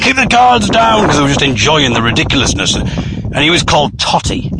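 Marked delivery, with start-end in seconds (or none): 0.0-1.1 high-pitched heckler yell, drawn out; imitated crowd shout. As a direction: call it out like a jeering audience. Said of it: "some people were shouting".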